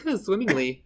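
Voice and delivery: a hint of a bubbly princess staying in role; mermaid in character. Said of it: "She's like".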